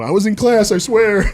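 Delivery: hammed-up frantic protest, high and loud; mock pleading. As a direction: squeal it like a caught schoolboy. Mock excuse to angry coach.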